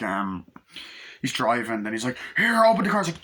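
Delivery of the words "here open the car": high, loud demand; acted urgent call. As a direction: call out to be let into the car. Acting out a man begging entry.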